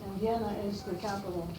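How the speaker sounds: usual speaking voice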